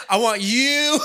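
hamming up the seductress, half laughing; sultry retold line. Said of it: "that's probably better".

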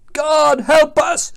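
high, loud plea for help; acted desperate shout; panicked sailor's cry. A narrator's reading. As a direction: cry out in storm-tossed alarm. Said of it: "cried a sailor".

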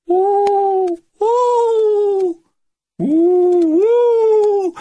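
repeated long, high, loud hoots; eerie high owl calls. A narrator's reading. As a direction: hoot like an owl, drawn out. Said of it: "That's an owl".

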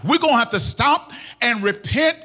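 high, insistent litany of pleading; fervent prayer preaching. Real, not acted. Preacher pleading to God for the church's forgiveness, fervent. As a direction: cry out each plea in driving repetition.

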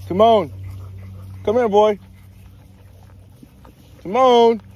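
high, loud call across the yard; calling from far away. Real, not acted. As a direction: shout the dog's name from afar. Calling dog from afar.

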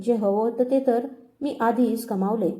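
plain reading voice

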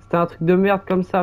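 ordinary talk, nothing marked

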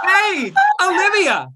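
"Hey, Olivia!" with a hard glottal pop, high and loud; glottal pop exclaim. Shown as a demonstration. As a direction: snap the voice on with a glottal attack. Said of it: "exclaim with a glottal pop".

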